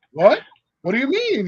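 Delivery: taken aback. Retold or imitated. Patient told he qualifies for surgery, taken aback. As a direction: blurt it out loud, then a high, baffled question.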